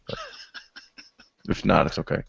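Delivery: normal talking voice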